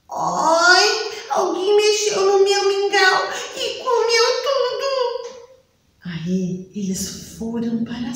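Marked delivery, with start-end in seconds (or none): 0.1-4.9 put-on much higher, sing-song childlike whine; little bear whine. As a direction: wail like a tiny bear cub. Ursinho lamenta mingau comido. (ursinho choramingando com voz aguda)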